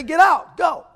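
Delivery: imagined outburst of a mad churchman; angry shout. Retold or imitated. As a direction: throw them out with a shout.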